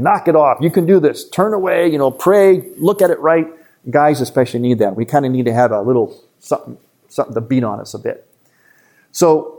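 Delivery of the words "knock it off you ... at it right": higher, urgent coach pushing you on; imitated tough coach in your head. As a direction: bark it like a coach urging a player. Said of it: "that tough voice that's just saying".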